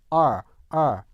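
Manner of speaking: drawn-out American R sound, tongue curled back; r-colored sound demo. Shown as a demonstration. Teacher demonstrating the R sound to students, drawn-out curled R. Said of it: "잘 들어보세요".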